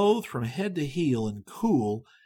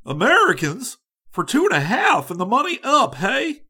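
loud, hearty call, higher than the narration; hearty cheerfulness; 9 semitones higher, 8 dB louder than usual. A narrator's reading. Young man hails two strangers in the street, heartily cheerful. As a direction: sing it out with a big friendly grin. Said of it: "sung out with a hearty cheerfulness".

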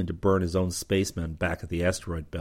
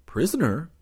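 bewildered; 9 semitones higher than usual. A narrator's reading. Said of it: "Rip looked bewildered".

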